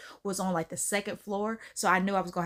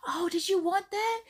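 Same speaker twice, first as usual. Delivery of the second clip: much higher, breezy innocent question; mock-innocent sister; 10 semitones higher than usual. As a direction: play dumb lightly. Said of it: "I was just like".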